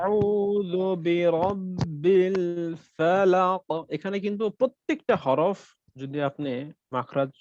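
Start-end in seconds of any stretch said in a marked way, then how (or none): 0.0-3.6 আউযু with a throaty ain, slow and drawn out; Quranic recitation demo. Shown as a demonstration. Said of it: "আইনের উচ্চারণ করতে হবে". (গলার গভীর থেকে আইন দিয়ে ধীরে আউযু বিরাব্বিল ফালাক)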